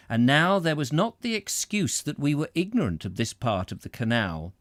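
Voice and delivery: lively, dramatic read-aloud; story narration. A narrator's reading. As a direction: tell it with mounting comic dismay. Reads on with drama.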